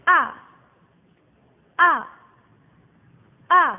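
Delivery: loud, short A like an angry person; falling tone. Shown as a demonstration. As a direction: bark A with a sharp drop. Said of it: "nadanya turun kayak orang marah".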